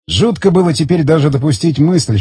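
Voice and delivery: ordinary delivery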